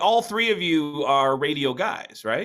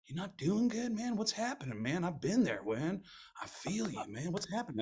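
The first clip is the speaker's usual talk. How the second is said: soft, higher voice, imitating a caring buddy; Southside voice; 7 semitones higher, 8 dB quieter than usual. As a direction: play Southside gently coaxing his friend during the commercial break. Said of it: "he goes".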